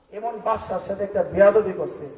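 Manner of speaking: normal talking voice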